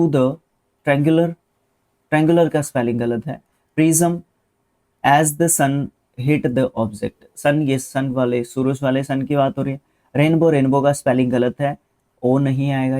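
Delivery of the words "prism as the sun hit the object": very slow, word-by-word reading; teacher dictates the sentence slowly. Shown as a demonstration. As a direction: drag the sentence out for students. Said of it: "reading the sentence slowly".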